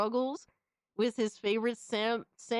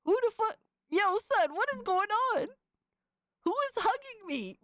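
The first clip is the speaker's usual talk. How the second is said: much higher, shocked yelps; startled outburst; 11 semitones higher than usual. Real, not acted. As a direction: react like someone just grabbed you. Startled by fan art on stream.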